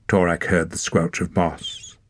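usual speaking voice

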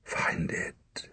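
urgent, broken whisper; dying father repeats his plea softly; whispered urging. Acted. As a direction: plead it with almost no voice. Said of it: "he said again".

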